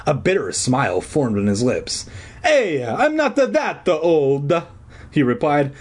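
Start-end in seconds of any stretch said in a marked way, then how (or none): 2.4-4.5 slow, high stage-Italian protest; Italian accent. A narrator's reading. Janitor objecting to being taken for older, Italian accent. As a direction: object in drawn-out hammed-up Italian. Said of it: "his hoarse Italian voice".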